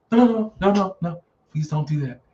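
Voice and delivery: high, rapid-fire mock panic; mock frantic pleading. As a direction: plead like a panicked movie victim. Voicing the screaming woman's pleading.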